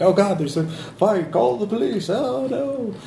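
high, slow panicked cries from frightened neighbours. Acted. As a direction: shriek it like scared onlookers. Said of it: "People are like".

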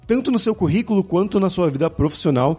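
lively, projected host sign-off and sponsor plug; podcast ad read. Acted. Podcast host plugs sponsor courses to listeners, upbeat ad read. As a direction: sell it with punchy, swooping announcer energy.

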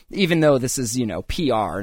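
ordinary talk, nothing marked

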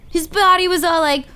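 plain reading voice

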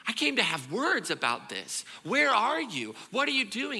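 shouted, pitched high, demanding answers; angry confrontation. Acted. Elijah yelling at God, demanding where he is. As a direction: storm in and yell up at God.

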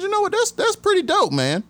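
impressed reply. Retold or imitated. Retelling to the host how he praised the dog owner, an excited high reply. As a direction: gush with impressed delight.

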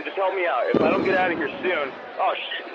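normal talking voice

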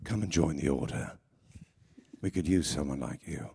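low, soft recruiting villain; Talbot. Acted. Villain asking fan Nikki to join the order, low soft Talbot voice. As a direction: invite them in, deep and hushed, with long pauses. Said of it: "positive message in Talbot's voice".

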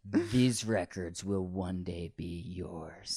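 slow, grave bequeathing voice; solemn parent. Pretend. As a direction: hand it down like a solemn heir-maker. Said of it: "take note and be like".

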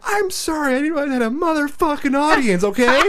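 high, loud mock apology, building to a shouted okay; retold angry retort. Dad snapping back at his ex over an audience, indignant yelling. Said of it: "I'm like".

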